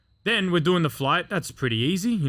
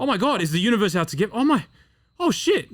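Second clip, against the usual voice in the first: high, fast retold freak-out; mounting panic; 8 semitones higher, 4 dB louder than usual. Man spiralling on psychedelics, panicked. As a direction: let panicky thoughts climb higher and higher.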